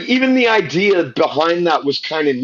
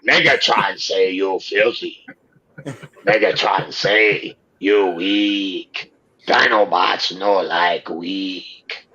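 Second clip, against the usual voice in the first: slow, lower caveman robot dinosaur; 4 semitones lower than usual. Pretend. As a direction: rumble it like a brutish Dinobot. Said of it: "Me Dinobots".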